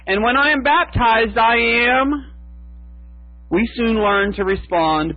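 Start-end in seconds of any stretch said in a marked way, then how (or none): 0.1-2.3 high, eager questioning voice with the pitch lifting at the end; lightly voiced hopeful woman asking to be named; a hint of a woman eagerly asking who she is; Fayette asking eagerly. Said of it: "would ask over and over".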